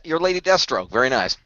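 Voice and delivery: normal talking voice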